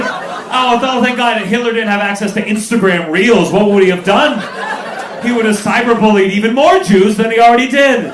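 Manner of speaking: loud, high, ranting sarcasm; hammed-up outraged irony; sarcastic mock relief. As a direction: shout it in pretend thankfulness.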